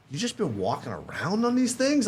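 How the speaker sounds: high-pitched retold disbelief; incredulous doctor. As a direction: act out a doctor who can't believe it. Doctor amazed at his broken legs.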